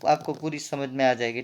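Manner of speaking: ordinary delivery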